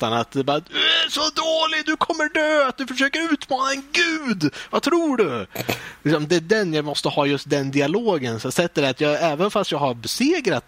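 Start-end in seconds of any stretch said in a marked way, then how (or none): 0.6-5.5 hammed-up boss scream; screaming god boss. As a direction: taunt like an almighty deity. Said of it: "står och skriker åt".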